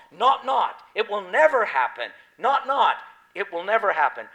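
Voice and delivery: "Not, not" shouted high and loud, "never" stressed; strong emphatic not. Shown as a demonstration. Preacher stressing God's double promise, loud and high. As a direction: hit both nots hard, like a promise. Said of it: "the emphasis in the Greek is God's is so strong".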